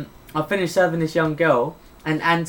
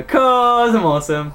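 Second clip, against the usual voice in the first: higher, slow smug boast; 5 semitones higher, 4 dB louder, slower than usual. Retold or imitated. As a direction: brag it smugly. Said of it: "And I'd turn around and go".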